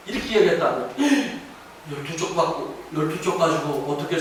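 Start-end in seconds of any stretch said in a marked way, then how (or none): none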